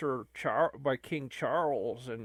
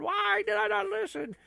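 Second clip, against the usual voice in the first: much higher, wailing take of the same line; high mock despair. Shown as a demonstration. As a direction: cry it out to the sky in fake anguish.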